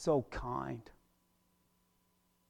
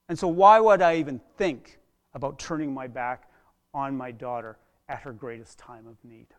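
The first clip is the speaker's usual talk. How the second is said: ordinary delivery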